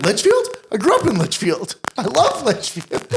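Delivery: high, gushing teacher voice; excited teacher. Retold or imitated. Science teacher gushing about her hometown, high and excited. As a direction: squeal it like a thrilled teacher. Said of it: "She was like".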